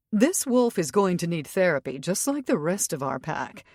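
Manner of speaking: ordinary delivery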